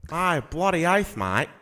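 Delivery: broad, jaw-heavy Australian; Australian accent. Shown as a demonstration. Host showing off the accent, jaw-heavy Australian. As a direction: work the jaw on Australian vowels. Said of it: "it's a lot of jaw".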